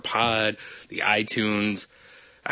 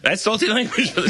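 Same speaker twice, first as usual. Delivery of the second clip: laughing; 11 semitones higher, 3 dB louder than usual. Pretend. Laughing mock sign-off.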